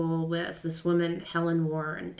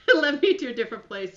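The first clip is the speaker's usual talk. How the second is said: laughing through the words, a bit quicker; 7 semitones higher, 4 dB louder, faster than usual. Real, not acted. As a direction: laugh it off lightly as you say it. Laughing after tearful car story.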